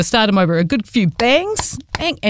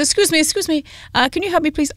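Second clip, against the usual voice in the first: light imitation of a French accent, pitched up; a hint of a French accent, higher; mock French accent; 8 semitones higher, 3 dB quieter than usual. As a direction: put on a gentle French accent for the stranger asking for help. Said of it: "not gonna keep doing the accent".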